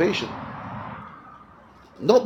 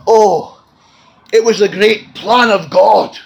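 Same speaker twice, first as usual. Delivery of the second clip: louder, slow, soaring proclamation; fervent preaching; 7 semitones higher, 7 dB louder, slower than usual. Real, not acted. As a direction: lift it up like a revival preacher. Preaching at full force.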